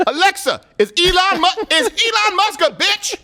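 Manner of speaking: high-pitched caricature voice; mock Sean King. Mock activist questioning his smart speaker, high put-on voice. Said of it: "It's me, Sean King".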